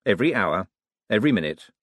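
ordinary delivery, nothing marked

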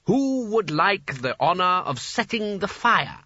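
high, slow, brightly inviting. A narrator's reading. Sconner invites the wizards to light the fire, bright and high. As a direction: ask it brightly, drawing the words out. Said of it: "said Sconner brightly".

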